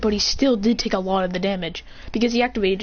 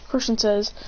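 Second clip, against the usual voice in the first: ordinary delivery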